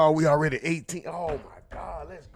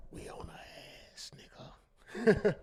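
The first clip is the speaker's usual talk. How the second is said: breathy, hushed whisper, drawn out; 7 semitones higher, 5 dB quieter, slower than usual. Pretend. Streamer whispers to chat about a guest, whispered. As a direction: lean in and whisper it like a secret.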